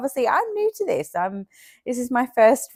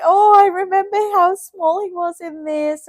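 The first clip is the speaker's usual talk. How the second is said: tender gush; 10 semitones higher than usual. Retold or imitated. Mum recalling baby's small outfit.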